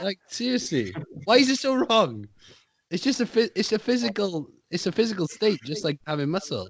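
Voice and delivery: ordinary talk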